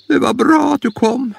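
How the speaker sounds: a high little granny voice; old grandma voice. Acted. Grandma greeting Bamse at the door, high granny voice.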